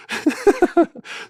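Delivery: high-pitched cackling laugh. Real, not acted. Storyteller amused by the praise he mimicked, laughing. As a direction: burst out laughing at your own mimicry.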